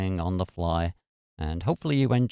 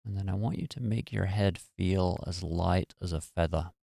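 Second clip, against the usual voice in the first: guided meditation; 4 dB quieter than usual. Real, not acted. Meditation host talking listeners through releasing muscle tension, guided meditation. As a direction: ease them gently into relaxation, unhurried and even.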